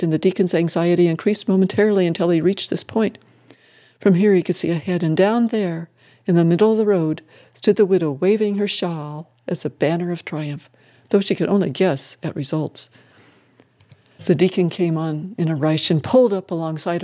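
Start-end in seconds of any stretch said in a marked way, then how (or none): none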